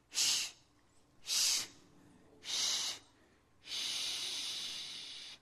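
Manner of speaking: fading whispered shh; hushing. Acted. Storyteller hushing the children before the bird comes. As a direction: hush them down to nothing.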